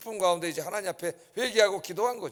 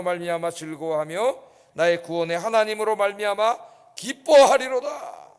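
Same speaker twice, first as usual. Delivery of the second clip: measured recitation swelling to a loud finish; scripture reading; 6 dB louder than usual. Real, not acted. Preacher leading the congregation in Habakkuk's song, solemn building recitation. Reads the scripture passage aloud.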